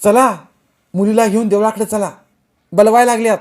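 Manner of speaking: high, urgent call-out; loud shouted summons. A narrator's reading. Someone rushing in calls the women to the temple, loud shout. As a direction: shout it as you come in from outside. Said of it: "मोठ्यानं ओरडून सांगितलं".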